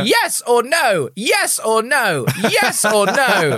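high, slow, mock demanding; mild mock shout. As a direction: call it after her, slow and pushy. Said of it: "He's shouting this down the corridor".

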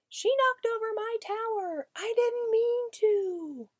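put-on high, slow, whiny little kid protesting; upset child voice. Child defending herself after knocking over a tower, whining high. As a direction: wail it like a child whose tower fell.

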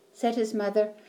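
ordinary delivery

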